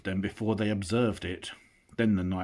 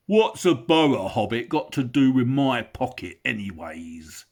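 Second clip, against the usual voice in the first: loud, higher rough troll voice; grumbling troll; 5 semitones higher, 8 dB louder than usual. Acted. William questions the hobbit, loud rough. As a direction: demand it like a suspicious oaf.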